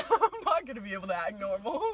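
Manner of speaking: giggling through the words; can't stop laughing while talking. Real, not acted.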